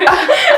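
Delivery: high loud burst of real laughter. Real, not acted. Friend cracks up over the terror joke, loud laughing burst. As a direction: let a big laugh explode out.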